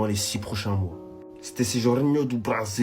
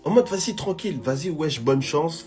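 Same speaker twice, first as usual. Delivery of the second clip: french street slang; 6 semitones higher than usual. Retold or imitated. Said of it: "tu connaissais l'argot des jeunes".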